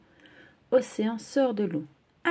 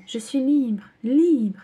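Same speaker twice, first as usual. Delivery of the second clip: higher, louder cry of freedom; joyful shout; 6 semitones higher, 7 dB louder, much slower than usual. Acted. Océan libéré bondit. (cri de joie, de plus en plus fort)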